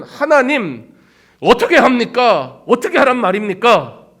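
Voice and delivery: loud, pleading cry to God; desperate plea. Retold or imitated. Moses pleading with God for help, desperate and loud.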